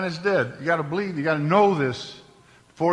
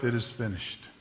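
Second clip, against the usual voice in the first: softer, lower last words; dying last breath; 4 semitones lower, 7 dB quieter than usual. Retold or imitated. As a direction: let it go out on the final breath. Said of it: "Final breath".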